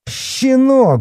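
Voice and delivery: very high, loud outraged cry; flaring up. A narrator's reading. Policeman taunted by the boy, flaring up. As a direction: explode in shrieking outrage. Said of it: "взвился полицейский".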